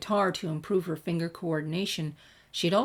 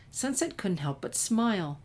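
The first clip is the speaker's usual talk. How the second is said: plain reading voice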